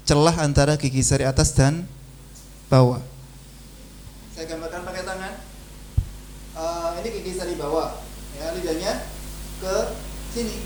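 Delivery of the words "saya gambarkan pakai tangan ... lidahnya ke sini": very quiet, fast aside. Real, not acted. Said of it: "teacher speaks very softly".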